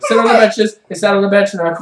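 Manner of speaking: normal talking voice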